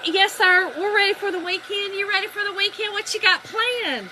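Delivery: high, eager Southern small talk; chirpy friendly reply. Retold or imitated. Wife answers stranger in line, high and chirpy.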